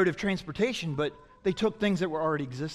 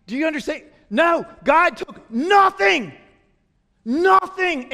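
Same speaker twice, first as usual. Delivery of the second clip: much higher, louder, slow cry of "nothing"; preaching at full force; 10 semitones higher, 8 dB louder than usual. Acted. Preacher proclaiming creation from nothing, loud and slow. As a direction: hammer the word home.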